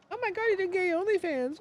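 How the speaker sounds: very high, falsetto squeal of a shocked gossip; mock gossip squeal. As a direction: squeak it like a scandalized fan. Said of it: "a bunch of stuff that was like".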